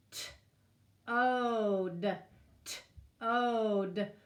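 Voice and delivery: T-ode split into slow separate sounds; segmented word. Shown as a demonstration. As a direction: break T-ode apart sound by sound. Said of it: "let's say the word together".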